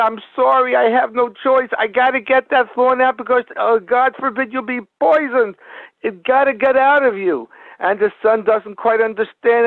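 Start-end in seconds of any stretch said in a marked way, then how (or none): 0.0-7.5 higher-pitched, urgent pleading without real tears; imitated tearful apology. Crying father to his bleeding son, lightly acted distress. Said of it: "he's crying and he said".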